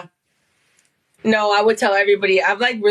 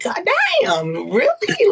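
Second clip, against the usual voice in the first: drawn-out disbelieving gasp, pitched way up; mock shock; slower than usual. Friend reading gossip DMs, slow high shocked "really". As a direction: react like a juicy DM just landed.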